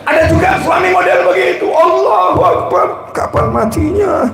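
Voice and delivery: high, exasperated mock scolding from the pulpit; hammed-up sermon outburst; impassioned preaching. Real, not acted.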